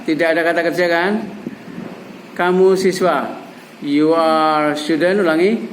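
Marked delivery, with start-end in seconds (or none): 3.8-5.2 slow, measured sentence for repeating; drawn-out teaching example; slow model phrase. Shown as a demonstration. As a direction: say it slowly for learners to echo.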